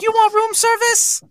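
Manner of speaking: high falsetto maid voice. Retold or imitated. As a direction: play the fake maid at the door. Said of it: "he's like".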